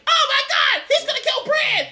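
reliving the scene, laughing and yelling; excited yell. Retold or imitated. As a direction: scream like the scene is live. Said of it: "And it was like".

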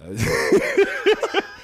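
breaking character giggling. Real, not acted. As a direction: burst of high giggles. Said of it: "I can't do it with a straight face".

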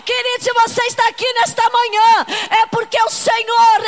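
loud, fervent preaching cry; full-force preaching. Acted. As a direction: preach it to the congregation with fire.

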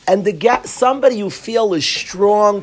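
usual speaking voice

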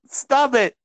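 normal talking voice

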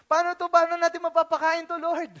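anxious disciples asking Lord; worried disciples. Acted. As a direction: panic over how to feed them all. Disciples worrying over feeding crowd.

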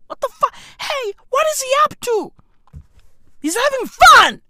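high-pitched disbelief building to a shout; incredulous outrage. Real, not acted. Podcaster ranting about the president's tour, high incredulous outrage. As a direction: question it high, then yell the answer.

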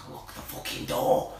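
whispered aside. Retold or imitated. Whispered conductor line.

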